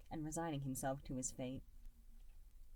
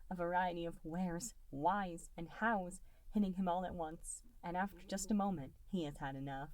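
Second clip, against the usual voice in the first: plain reading voice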